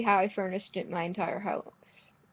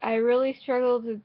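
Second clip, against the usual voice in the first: normal talking voice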